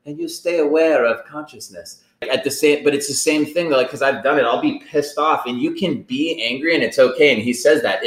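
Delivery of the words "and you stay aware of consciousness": lightly voiced old monk, a bit higher; a hint of a gentle elderly monk; imitating the old Buddhist monk with a light touch. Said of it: "hearing it from this ninety-year-old, like, Buddhist monk".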